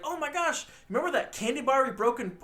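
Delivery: high, gushing greeting; mock excitement. Dora meeting her cousin again, mock excited.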